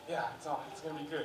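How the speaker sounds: quietly happy with snacks; contented to himself. Retold or imitated. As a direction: moviegoer settling in, softly pleased. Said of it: "I'm like".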